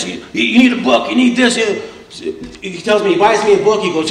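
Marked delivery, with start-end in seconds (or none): 0.3-1.6 higher, insistent mock pestering; retold pushy urging. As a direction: imitate pushy friends piling on. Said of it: "these two just jump on me".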